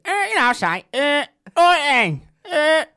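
hammed-up comic drawl; Gary Poundland impression. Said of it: "Let's hear it again".

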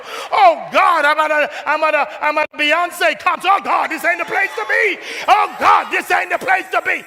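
high, loud panicked churchgoer; anxious stammering. Acted. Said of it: "you're going".

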